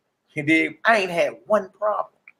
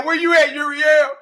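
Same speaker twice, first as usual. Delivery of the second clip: mock taunt; 6 semitones higher, 4 dB louder than usual. Trash talk after hitting three.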